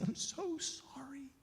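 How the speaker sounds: high, slow, broken whisper; barely voiced, drawn-out sorry; whispered apology. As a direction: apologize in a grief-stricken hush. Dying man's tearful apology.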